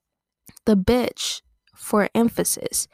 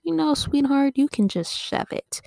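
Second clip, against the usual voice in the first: sweet grandma; 7 semitones higher than usual. Retold or imitated. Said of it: "That's the grandma way of saying".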